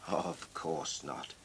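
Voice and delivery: Father Christmas calming the worried Mickets, low and slow. Acted. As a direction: reassure them slowly in a deep voice.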